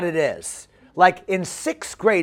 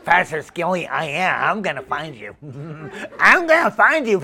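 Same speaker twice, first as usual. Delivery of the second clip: Bobby. Retold or imitated. Camper vowing to find pastor, goofy then insistent. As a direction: mimic an obsessed boy with snickers. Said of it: "Bobby's like".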